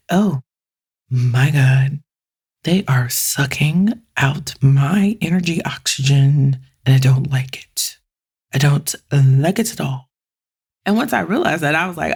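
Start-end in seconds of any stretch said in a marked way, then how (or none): 0.1-10.1 mock drained; drained and put out. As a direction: moan as if your energy is draining away. Acting drained by an extrovert.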